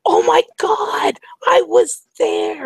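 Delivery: high, slow, drawn-out squeal; ecstatic shriek. Retold or imitated. Retold girls amazed at shared concert, ecstatic shriek. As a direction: imitate a girl screaming in amazed delight.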